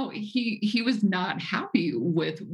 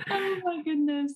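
cracked up, catching your breath; laughing; 13 semitones higher than usual. Real, not acted. Host reacting to the arm-in-the-air joke, helpless laughter.

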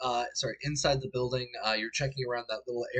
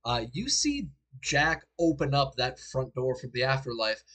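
usual speaking voice

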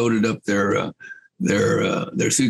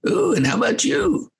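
much higher mock lady's voice; imitating English woman. English woman turning to Casey, mock high voice. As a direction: ask it in a prim, high lady's voice.

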